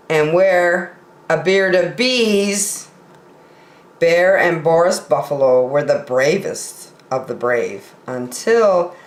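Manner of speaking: ordinary delivery, nothing marked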